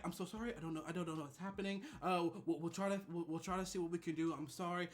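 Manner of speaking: soft, hushed, flustered apology; whisper-quiet sorry, trying to calm things; nervous apology. Retold or imitated. Receptionist apologizing to Rebecca, soft and flustered. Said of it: "So she's like".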